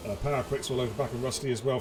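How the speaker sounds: ordinary talk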